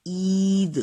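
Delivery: عید called out loudly as a model; عید sounded out for pupils; model word. Shown as a demonstration. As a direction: say عید with a clear long ee. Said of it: "اب میں بول رہی ہوں".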